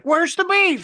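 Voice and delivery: put-on high, loud old lady squawk. Retelling the eighties Wendy's ad, shrill old lady. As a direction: crank it up like a cranky old lady. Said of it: "It was an old lady".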